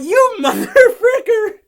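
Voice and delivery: high, slow, laughing curse; you motherfucker, cracking up in disbelief. Real, not acted. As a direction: curse at the screen through laughter. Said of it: "I'm sitting like".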